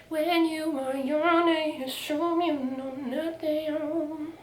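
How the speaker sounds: slow, soft put-on voice; mock message voice. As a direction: read it out slowly in a silly voice. Reading a message in voice.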